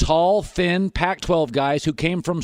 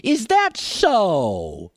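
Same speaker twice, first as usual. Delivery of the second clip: sarcastic; much slower than usual. Retold or imitated. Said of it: "And I'm like".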